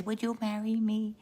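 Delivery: high, soft, coy asking; hopeful proposal. Acted. Young lady asks the young man to marry her, high and hopeful.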